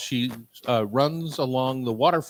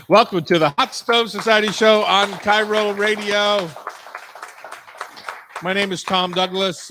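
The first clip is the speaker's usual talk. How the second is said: radio announcer; 7 semitones higher, 8 dB louder than usual. Real, not acted. Opening the radio show.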